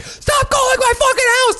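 high, screeching mock yell; angry lady. Imitating the prank-called lady yelling at the caller in a high shriek. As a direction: shriek it like a furious housewife. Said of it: "this lady's like".

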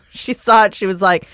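normal talking voice

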